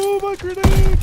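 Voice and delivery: sudden high panicked yell; grenade warning yell. Real, not acted. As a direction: shout it as your grenade blows up. Own grenade nearly kills him.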